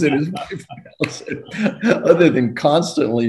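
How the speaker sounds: laughing. Real, not acted. Laughs telling the story.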